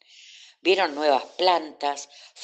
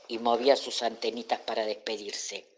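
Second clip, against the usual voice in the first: plain reading voice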